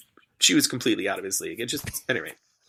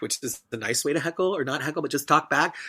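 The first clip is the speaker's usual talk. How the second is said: ordinary delivery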